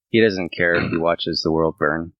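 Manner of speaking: normal talking voice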